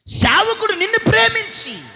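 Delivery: high, loud cry of love; loud sermon shout; preacher's shout. Acted. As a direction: cry it out from the pulpit.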